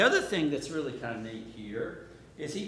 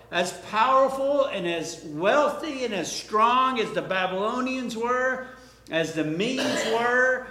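loud, high preaching, words hammered out; preaching at full force; 5 semitones higher, 6 dB louder than usual. Acted. Preacher building to a loud climax for the congregation. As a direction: drive the image home to the congregation. Preaching on the wrecking-ball stone.

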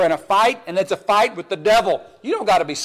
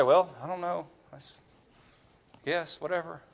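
much softer shrugging mumble; mock indifference; 4 semitones lower, 12 dB quieter than usual. Preacher mimics a husband who won't defend his wife, softer shrug. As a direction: play the husband who can't be bothered.

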